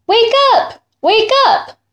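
high bright calling voice, rising sun; sun calling wake-up. Acted. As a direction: call out high to wake the farm. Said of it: "the rising sun called".

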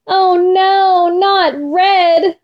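high, drawn-out storybook dismay; child's horrified cry, toned down; mock scream. A narrator's reading. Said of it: "I screamed".

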